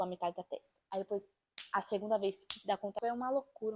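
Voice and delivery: ordinary delivery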